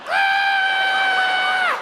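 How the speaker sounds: very high, sustained mock scream; crowd's scream. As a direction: shriek on one long high note. Whole crowd screams at snake.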